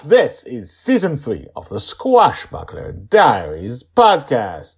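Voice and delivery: big, high opening shout settling into a loud announcement; podcast announcer. Acted. Host opens the new season to listeners, rousing announcer voice. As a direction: punch out the show intro like a hype announcer.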